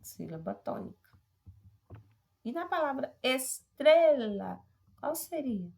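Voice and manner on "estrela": TRE of "estrela" loud and drawn out; stressed middle syllable. Shown as a demonstration. Teacher asking which syllable is tonic, TRE in "estrela" stressed. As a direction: push and hold TRE in "estrela".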